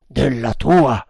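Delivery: mildly astonished retort; astonished exclamation. A narrator's reading. Captain answers the Indian, astonished exclamation. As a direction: throw it back in amazement. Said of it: "esclamò il capitano stupito".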